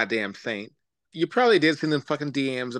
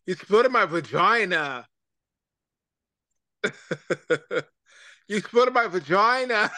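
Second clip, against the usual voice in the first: higher, hammed-up repeat; mock quote; 3 semitones higher than usual. Mocking a castmate's line, hammed-up repeat. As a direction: ham up the quote, drawn out.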